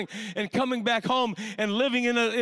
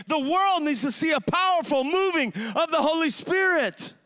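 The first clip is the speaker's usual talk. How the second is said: full-force revival preaching; fiery preaching; 5 semitones higher than usual. Real, not acted. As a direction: preach it hard to stir the room.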